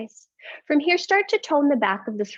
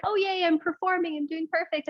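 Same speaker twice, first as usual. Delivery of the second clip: mock glee; 7 semitones higher than usual. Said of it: "it's like".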